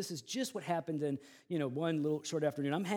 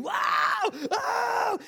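loud, high-pitched pained howl; imitated scream; 5 dB louder than usual. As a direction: mimic a stung kid screaming. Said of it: "he starts screaming".

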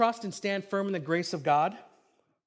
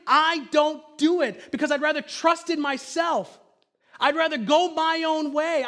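raised, high-pitched sermon delivery; impassioned preaching; 7 semitones higher, 4 dB louder than usual. Real, not acted. Preacher confessing his own disobedience to congregation, impassioned. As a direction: drive each confession home forcefully.

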